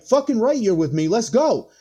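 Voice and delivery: very high, hyped; excited welcome. Retold or imitated. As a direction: pull a buddy on stage with a cheer. Friend welcomes him on stage.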